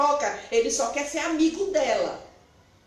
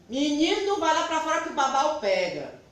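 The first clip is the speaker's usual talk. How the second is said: mock scare warning to a boy; mild mock mother's warning, slightly raised; scolding mother; 4 semitones higher than usual. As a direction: lightly threaten the child with the bogeyman. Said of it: "Era assim que eu ensinava".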